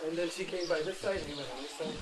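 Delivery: ordinary talk, nothing marked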